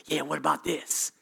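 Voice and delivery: soft, sly voice of the tempter; mock whisper, sly and low; a hint of a devil murmuring in your ear; enemy whispering. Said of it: "the enemy's whispering in your ear saying".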